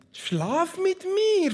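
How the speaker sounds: high, slow, hammed-up seductress; seductive demand. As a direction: drawl the invitation up high like a temptress. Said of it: "forderte sie ihn auf".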